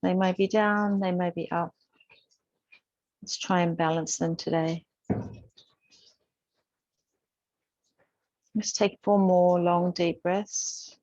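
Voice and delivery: calm, unhurried instructor voice with long pauses; even, measured cues, leaving space to breathe; guided yoga meditation. Real, not acted. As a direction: gently guide a class through breath and movement. Leads breathing and gentle yoga.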